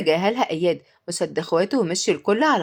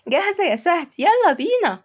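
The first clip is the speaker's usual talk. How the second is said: high, eager little call; enthusiastic; 9 semitones higher than usual. A narrator's reading. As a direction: chirp it, raring to go. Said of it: "تيا بحماس".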